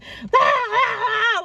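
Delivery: high mimicked screech down the phone; hammed-up wild shriek; crazed screaming. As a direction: imitate a woman screaming nonstop. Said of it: "she just was going crazy".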